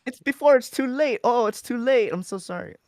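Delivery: high, loud sales pitch; urgent TV ad countdown; infomercial voice. Pretend. Joking infomercial bit.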